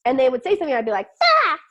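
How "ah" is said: sudden high, loud cry of overwhelm; frustrated yell. Retold or imitated. To her parents, a high, loud overwhelmed yell. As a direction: let out a sharp high yelp.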